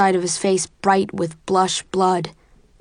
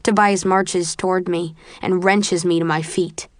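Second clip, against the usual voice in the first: ordinary delivery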